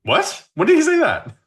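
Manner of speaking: high, loud disbelieving question; shocked. Real, not acted. Cohost hearing Pascal's quote, shocked and incredulous. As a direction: pitch shoots up in disbelief.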